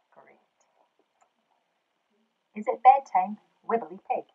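usual speaking voice

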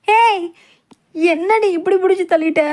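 loud high outraged yell; sharp loud protest; shout. Acted. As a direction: cry out after being shoved. Pushed, cries out.

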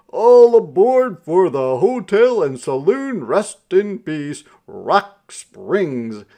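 loud, high sleigh-driver's call; hollering. A narrator's reading. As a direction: holler it out like a driver touting for riders. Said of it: "the driver hollered".

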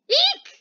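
short, very high squeak; high, loud startled squeal; startled eek. Acted. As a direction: yelp a mock-frightened eek. Acts startled by the teacher.

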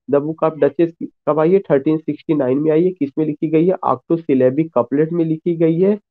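ordinary talk

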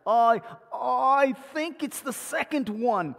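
slow, unsure answer down the phone; hesitant Cockney friend guessing. Retold or imitated. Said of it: "Peter answering hesitantly".

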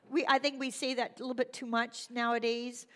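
ordinary delivery

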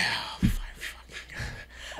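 slow, breathy whisper of disbelief; disbelieving whisper. Retold or imitated. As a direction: mutter it slowly under your breath. Stunned aside about the blocker.

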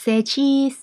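high, loud, cheery "Say cheese"; photographer's cheery call. Shown as a demonstration. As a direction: coax a smile for the camera.

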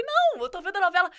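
high, laughing reply. Retold or imitated. Telling her husband she is only watching the novela, laughing. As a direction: giggle through the words. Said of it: "Eu falei".

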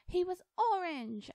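high, loud, drawn-out outburst; almost yell it, pitched right up and slow; indignant high near-shout. Acted. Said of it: "Alice near yelled".

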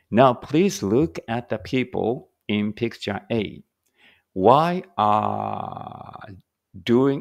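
slow, careful English reading for learners; slow read-aloud. Shown as a demonstration. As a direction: read each word out slowly and clearly. Reads examiner prompt slowly. (ゆっくり丁寧な英語の音読)

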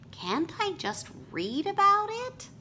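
high, light pleading; pleading bunny son. Acted. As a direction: ask it like a hopeful little bunny. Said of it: "Henry pleads".

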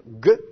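a short, loud, hard "gue" stop; hard stopped g. Shown as a demonstration. Teacher demonstrates the stopped guimel to students, loud and hard. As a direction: punch out "gue" as a clean stopped g.

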